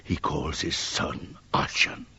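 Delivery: hushed unvoiced whispers; whispered. Whispering to each other about the son. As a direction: breathe it, barely a sound.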